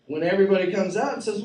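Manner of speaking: ordinary talk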